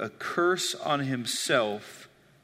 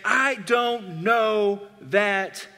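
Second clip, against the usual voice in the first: slow, drawn-out denial, higher; a preacher voicing Peter's heated denial; mock angry denial; 3 semitones higher, much slower than usual. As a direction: lean on each word like a cornered man. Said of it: "cursing himself, and saying".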